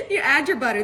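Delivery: talking through giggles; real amused laughter in the voice; laughing. Real, not acted. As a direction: get the words out while laughing. Giggling after the accent teasing.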